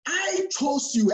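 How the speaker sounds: slow, high "I", falling through "chose you"; stress on I. Shown as a demonstration. Preacher stressing that Jesus does the choosing, stress on "I". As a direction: punch and lengthen the word "I". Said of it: "Emphasis on here".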